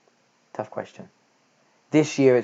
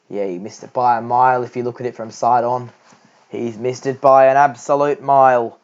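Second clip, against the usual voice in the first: put-on Michael Slater accent; 2 semitones higher, 6 dB louder than usual. Calling Labuschagne's LBW review in an imitated Australian accent. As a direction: call it like an excitable Australian TV commentator. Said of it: "I can easily do a Michael Slater accent".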